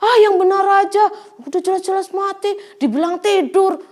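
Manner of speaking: mocking scoff. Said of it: "Mereka menertawakan".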